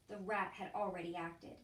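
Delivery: plain reading voice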